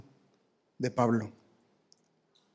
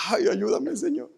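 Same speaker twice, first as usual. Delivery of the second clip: high desperate plea, rising, much higher; pleading prayer; 8 semitones higher, slower than usual. Pretend. Paul in prison crying to the Lord, high desperate plea. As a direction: cry out to God in anguish. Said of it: "en la prisión".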